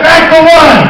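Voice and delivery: very loud, high, slow drawn-out yell; director's shout. Retold or imitated. Director yelling the crew back to start, drawn-out shout. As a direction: bellow at the whole crew. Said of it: "Sam goes".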